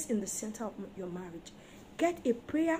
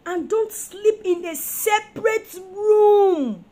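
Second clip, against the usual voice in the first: loud, high, slow tearful cry; crying plea; 8 semitones higher, 11 dB louder, much slower than usual. Real, not acted. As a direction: wail the plea. Preacher pleading with couples.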